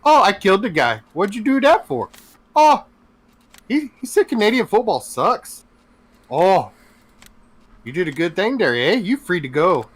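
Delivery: Canadian cop approving a killing over football; mock Canadian exchange. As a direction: play both cop and killer as nice Canadians. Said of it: "He's like".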